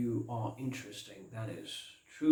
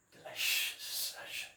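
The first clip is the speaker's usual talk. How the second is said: savouring; 7 dB quieter than usual. Acted. Vampire savours the taste.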